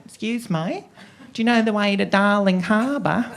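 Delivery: Australian woman. Retold or imitated. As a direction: imitate an Australian woman politely asking the way. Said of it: "you imagine she asked me for directions".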